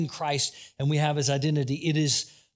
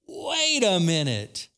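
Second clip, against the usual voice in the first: slow, drawn-out dawning realization; acted moment of coming to one's senses; sudden realization; slower than usual. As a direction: stretch it out as it dawns on you. Prodigal son comes to his senses.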